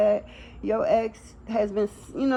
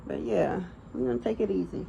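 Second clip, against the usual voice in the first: ordinary delivery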